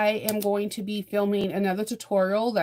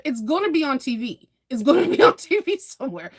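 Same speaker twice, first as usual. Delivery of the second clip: higher and louder, laughing through it; amused reassurance with laughter; 5 semitones higher, 5 dB louder than usual. Real, not acted. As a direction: giggle while you reassure.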